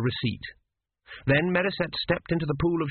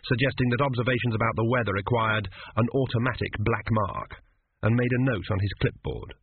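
ordinary delivery

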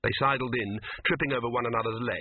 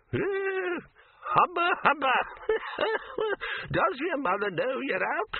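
put-on cackling old man; slower than usual. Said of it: "he cackled".

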